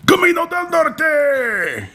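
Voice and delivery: put-on very high, drawn-out shout in a Mexican accent; mock Mexican accent. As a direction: shout it like a hammed-up Mexican announcer. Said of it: "tries to add the accent to that".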